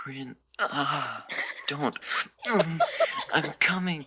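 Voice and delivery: a lover crying out at climax; climaxing cries. Acted. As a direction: let it climb into loud pleading cries. Haru climaxing, loud cries.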